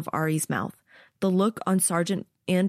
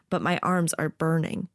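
plain reading voice

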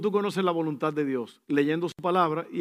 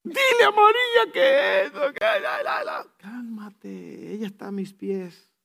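playful voice of Jesus; 7 semitones higher, slower than usual. Retold or imitated. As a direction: tease gently, then break into a comic squeal. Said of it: "lo que el Señor le dijo a Marta". (Jesús le dice a Marta, juguetón y suave)

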